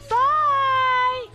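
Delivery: ordinary talk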